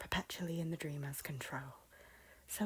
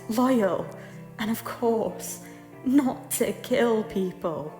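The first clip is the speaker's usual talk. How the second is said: impassioned plea; 3 semitones higher, 8 dB louder than usual. Acted. Lady telling her lord what would end her suffering, loud and insistent. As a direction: drive each demand home forcefully. Lady's climactic plea in quarrel.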